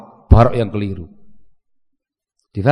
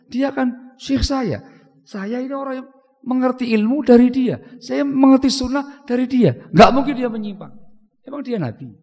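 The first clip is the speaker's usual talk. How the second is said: higher, whiny defensive protest; mock loyal follower; 7 semitones higher than usual. Preacher mocking followers who defend their sheikh, higher whiny protest. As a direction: mimic someone excusing their teacher.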